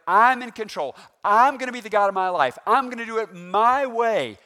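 loud, high boast of a self-made god; mock the proud man claiming control. Preacher mimicking a proud sinner, loudly boasting. Voicing prideful self-talk.

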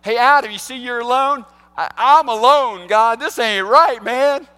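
loud, high playful God voice; God loudly calling Adam awake. Acted. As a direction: call to Adam, big and bright. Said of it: "God's like".

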